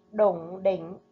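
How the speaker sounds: Đủng đỉnh with a clear inh rhyme in đỉnh; careful pronunciation. Shown as a demonstration. Teacher modelling Đủng đỉnh for pupils. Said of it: "chú ý phát âm ở vần Inh trong tiếng đỉnh".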